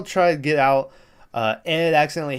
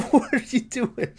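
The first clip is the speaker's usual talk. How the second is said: high, incredulous question through laughter; scandalized and giggling; laughing disbelief; 10 semitones higher than usual. Retold or imitated. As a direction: crack up while protesting. Reacting to shocking line, laughing.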